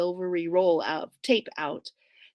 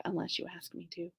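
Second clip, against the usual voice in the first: soft, muttered aside; mumble; 3 semitones lower, 14 dB quieter, faster than usual. Acted. Bridger muttering a flirty aside to himself. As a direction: mutter it under your breath. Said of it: "him mumble something".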